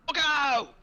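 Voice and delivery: high, loud shout of alarm; yelled warning. Acted. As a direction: yell a warning to the whole group. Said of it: "as I'm yelling".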